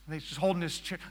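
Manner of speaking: normal talking voice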